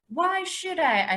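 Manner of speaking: high, slow, half-yelled challenge; practically yelling. A narrator's reading. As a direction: fling the question back. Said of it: "I was practically yelling".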